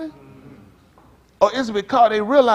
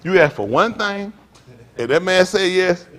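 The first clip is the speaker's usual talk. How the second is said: usual speaking voice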